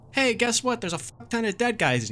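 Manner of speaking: high, mock-cheerful sarcasm; bitterly sarcastic. Sarcastic retelling of finding bodies.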